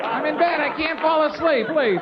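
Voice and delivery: put-on high-pitched, pleading old lady cry for help; distressed grandmother. Grandmother calls for help from the store, mock-distressed. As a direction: wail it like a helpless granny.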